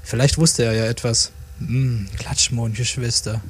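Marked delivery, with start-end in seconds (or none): 1.6-3.4 slow, lightly acted musing; hesitant hum, then slow words as if searching memory; pondering. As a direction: mull it over slowly, thinking aloud. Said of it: "grübelte Weedy".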